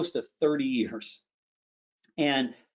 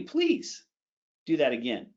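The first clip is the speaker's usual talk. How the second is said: happy; 5 semitones lower than usual. Shown as a demonstration. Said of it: "Are you gonna be happy?".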